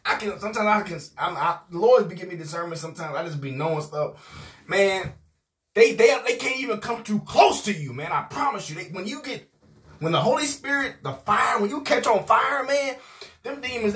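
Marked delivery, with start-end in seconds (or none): none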